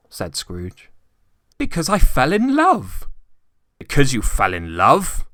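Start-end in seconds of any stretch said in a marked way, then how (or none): 1.6-3.1 high, loud, heartfelt declaration; earnest young nephew. A narrator's reading. Nephew answers Scrooge. 3.8-5.3 louder scornful growl. A narrator's reading. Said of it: "growled Scrooge".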